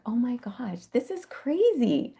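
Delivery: high, hushed, slow wonder; moved by the elephant's play, high and soft; amazed. Retold or imitated. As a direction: breathe it out in disbelief. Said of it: "I was like".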